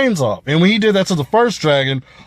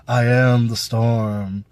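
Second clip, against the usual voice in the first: mocking a weak delivery; 5 semitones lower, much slower than usual. Reviewer mimicking Euron's underwhelming line, low and limp. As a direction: say it with no menace at all. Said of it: "he kinda came out there like".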